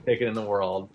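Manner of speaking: ordinary talk, nothing marked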